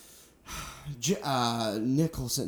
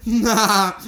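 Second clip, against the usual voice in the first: nervous laugh; 7 semitones higher, 5 dB louder than usual. Retold or imitated. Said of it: "Philip Seymour Hoffman being like".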